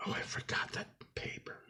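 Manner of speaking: a breathy whisper to himself; whispered aside. Real, not acted. Realizing mid-repair he forgot the paper, whispering to himself.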